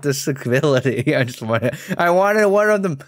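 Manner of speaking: high, loud wail of frustration; loud exasperation. Real, not acted. Player lamenting playing too much with the ability, loud and exasperated.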